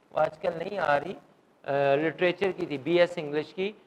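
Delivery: normal talking voice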